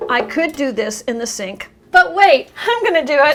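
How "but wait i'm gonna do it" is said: excited. Real, not acted. Sudden interruption while washing.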